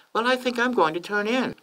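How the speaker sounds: high, thin falsetto for a wife; Lady Bird. A narrator's reading. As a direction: tell your husband in falsetto. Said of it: "Lady Bird informed her husband".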